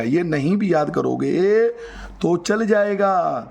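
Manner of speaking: very slow, with long stretched phrase endings; drawn-out repetition. Shown as a demonstration. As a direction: stretch it out teasingly for the students. Repeats it drawn out for emphasis.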